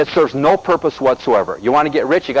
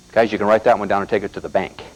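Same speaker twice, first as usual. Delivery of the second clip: usual speaking voice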